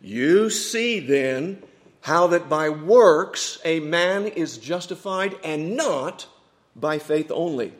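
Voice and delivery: slow, drawn-out "You see then", stress on "works"; repeated with emphasis. Retold or imitated. Debater rises to repeat his one verse, with emphasis. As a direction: lift "not" and let "by faith only" settle. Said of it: "he repeated with emphasis".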